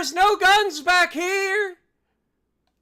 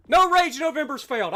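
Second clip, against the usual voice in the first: ordinary talk, nothing marked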